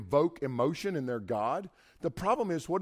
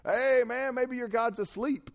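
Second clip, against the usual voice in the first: much higher, teasing jab; mocking trash talk; 9 semitones higher than usual. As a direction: needle them with a sneering taunt. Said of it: "Elijah starts talking trash to him".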